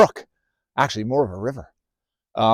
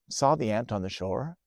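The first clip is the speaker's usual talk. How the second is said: usual speaking voice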